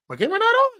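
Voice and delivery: put-on high, surprised old mom voice; a confused mother on the phone; imitating mom. Son imitating his confused mother on FaceTime, high puzzled voice.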